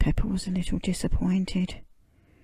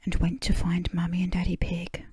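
plain reading voice

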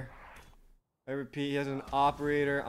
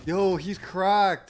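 hyped shout; 5 semitones higher, 8 dB louder, much slower than usual. Real, not acted. Hyped at enemy play.

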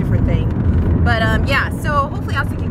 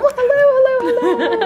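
much higher, bright sing-song hellos; excited greeting; 4 dB louder, slower than usual. Real, not acted. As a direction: greet a friend with delight.

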